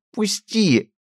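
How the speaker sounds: high strained cry to be let go; pained squeal. A narrator's reading. Upstart squeals to be released from the grip. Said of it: "выгибая спину от боли".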